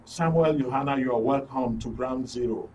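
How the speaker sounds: normal talking voice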